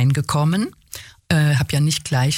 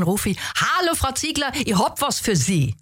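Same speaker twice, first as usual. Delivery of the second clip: high-pitched mock call in Viennese; 8 semitones higher than usual. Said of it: "rufe ich".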